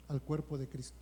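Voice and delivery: normal talking voice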